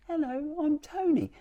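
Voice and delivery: tiny high-pitched key voice, softly; Tony, little whisper. Acted. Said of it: "says it in a little whisper".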